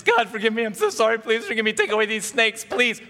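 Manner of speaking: high, loud, hammed-up begging; repentant pleading. People praying to God to remove snakes, pleading. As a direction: plead desperately for forgiveness. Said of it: "a prayer of repentance".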